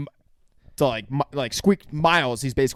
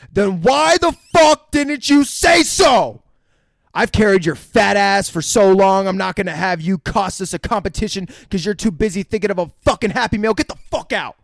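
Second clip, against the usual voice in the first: yelling; 6 semitones higher, 7 dB louder than usual. Retold or imitated. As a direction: bellow it at the kid. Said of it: "Simon yells".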